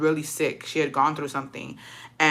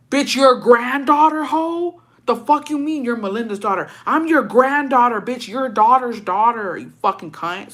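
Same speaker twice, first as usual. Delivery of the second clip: loud, higher, indignant rant; getting mad; 6 semitones higher, 4 dB louder than usual. Retold or imitated. Granddaughter answering grandma who forgot her, mad, raised-voice rant. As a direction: lose your temper reliving the comeback. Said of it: "I'm getting mad all over".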